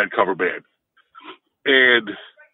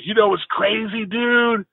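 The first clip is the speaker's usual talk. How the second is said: higher, slow, laid-back California surfer voice; 10 semitones higher than usual. Retold or imitated. Big stoned son in the back seat greeting the driver, higher California surfer voice. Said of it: "California-ish surfer guy".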